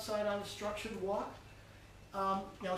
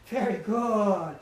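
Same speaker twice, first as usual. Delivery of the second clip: slow, stretched praise to a sitting dog; drawn-out dog praise; 6 dB louder, much slower than usual. Real, not acted. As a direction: stretch the praise out, rewarding him. Trainer praising dog during training.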